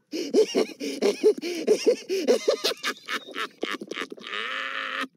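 long, high staccato giggling laugh; hammed-up helpless cackling that keeps building; frog's laughing fit. A narrator's reading. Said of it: "Tiddalik was laughing".

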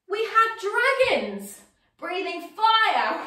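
high, slow, laughing; playful dragon. Shown as a demonstration. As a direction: ham it up for the children. Acting out dragon pose.

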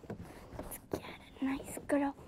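hushed whisper before the release shot. Real, not acted. Angler whispering to the camera before releasing the fish. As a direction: whisper it so you don't spook the moment.

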